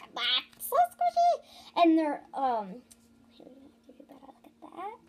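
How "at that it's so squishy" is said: very high, louder squeal; high-pitched cooing squeal; excited squeal. Real, not acted. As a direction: squeal with delight. Cooing over a squishy toy.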